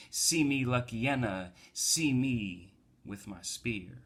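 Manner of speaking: performed poem with drawn-out line endings; spoken-word poem. Acted. Recites own poem after chat.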